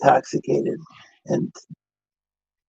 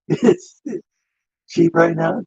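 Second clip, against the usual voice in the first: ordinary talk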